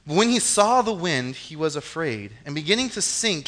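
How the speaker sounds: ordinary talk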